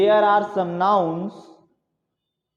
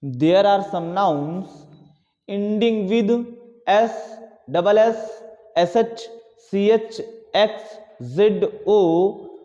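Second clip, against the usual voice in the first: very slow, spelled-out dictation voice; 2 semitones higher, much slower than usual. Shown as a demonstration. Teacher dictating to class, slow and spelled out. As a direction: drag every word out so they can write.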